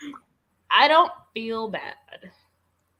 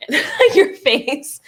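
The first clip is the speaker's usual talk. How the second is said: laughing through the words, slow and high; can barely get it out for laughing; giggling at her face; 11 semitones higher, 7 dB louder, much slower than usual. Real, not acted. Laughing at friend's reaction.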